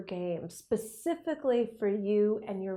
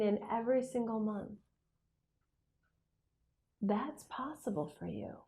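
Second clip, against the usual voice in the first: guided meditation. Acted. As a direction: guide them calmly with pauses. Leads a visualization.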